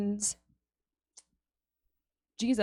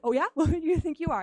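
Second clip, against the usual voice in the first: higher, louder mock-defiant retort; playful defiance; 7 semitones higher, 6 dB louder, faster than usual. Woman firing back at Jesus, playfully defiant. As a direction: toss it back with sass. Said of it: "she's like".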